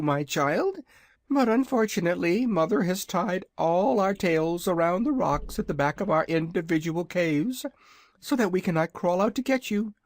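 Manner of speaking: high, proud little dragon voice; a boastful baby dragon, pitched high; young dragonette. Acted. Dragonette speaks to visitors.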